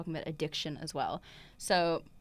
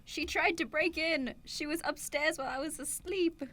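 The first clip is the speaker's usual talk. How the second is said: put-on high frail old Southern woman; lightly voiced old Southern lady, accusing; old woman Southern accent; 10 semitones higher than usual. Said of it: "old woman Southern accent".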